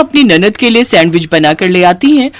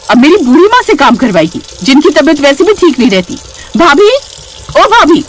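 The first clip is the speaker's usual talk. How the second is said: acted outraged shouting, pitched up; angry yelling; 6 semitones higher than usual. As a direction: scold and summon the sister-in-law. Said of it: "नैना जोर जोर से चिल्लाने लगती है".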